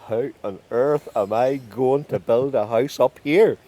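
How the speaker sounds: loud, slow, emphatic despair; exasperated disbelief. A narrator's reading. Stranded man faces an impossible task, exasperated. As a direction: lean on each word in disbelief.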